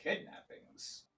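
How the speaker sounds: slow, whispered echo of the word; hushed repeat. Acted. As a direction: breathe the word back in disbelief. Bartender repeats in hushed surprise.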